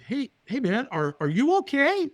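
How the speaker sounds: a tentative, high-pitched are-you-okay; mock concern. Stranger stops to check on a nervous firewalker, mock concern.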